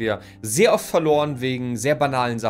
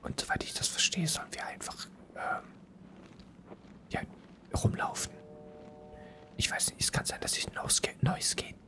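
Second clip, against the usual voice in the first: hushed whisper, keeping silent; 7 dB quieter than usual. Real, not acted. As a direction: whisper every word.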